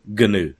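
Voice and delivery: "gnu" said as "guh-noo"; sounded g. Shown as a demonstration. Showing the right way to say it, g sounded in "gnu". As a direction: voice the hard g in "gnu". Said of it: "habit of saying things right".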